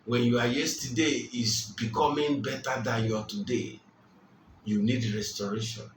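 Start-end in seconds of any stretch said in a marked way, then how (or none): none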